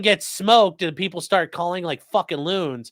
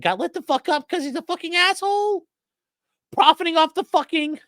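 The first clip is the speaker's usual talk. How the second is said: much higher falsetto, sneering at the caller; mock the caller in a falsetto; castrato voice; 13 semitones higher than usual. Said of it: "doing the fucking castrati thing".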